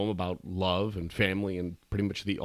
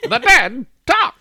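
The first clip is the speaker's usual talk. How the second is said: put-on high, loud old newsreel announcer; 13 dB louder than usual. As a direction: boom it out like a 1940s newsreel. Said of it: "[name] sounds like the, uh, the newsreel guy".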